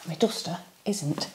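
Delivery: quick whispered aside. Real, not acted. Quick whispered aside about car.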